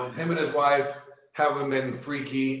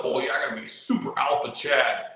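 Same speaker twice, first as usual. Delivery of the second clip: mock alpha bro; 3 semitones lower, faster than usual.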